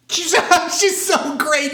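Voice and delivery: high, loud, breaking into laughter; real laughter. Real, not acted. Host cracking up over the drunk duchess scene, laughing. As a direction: laugh through the praise, delighted. Cracks up after own impression.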